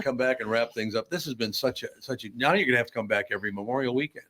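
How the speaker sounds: ordinary delivery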